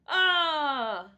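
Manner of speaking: sudden loud high "ah"; loud gasp of realization. Real, not acted. Remembering mid-sentence what she forgot, a loud startled cry.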